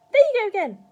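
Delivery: put-on high, very loud old woman's scold; cried out. A narrator's reading. Grandma telling George off, crying out. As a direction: cry it out in exasperation. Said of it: "she cried".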